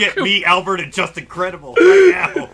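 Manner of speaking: loud laughing booker command; mock booker demand. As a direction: bark it like a laughing boss. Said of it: "They were like".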